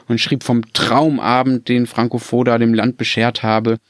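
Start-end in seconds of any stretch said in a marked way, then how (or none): none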